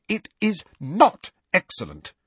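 high, emphatic contradiction; cross. A narrator's reading. Hermione snatches the clipboard from Fred, cross.

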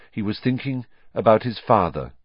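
plain reading voice

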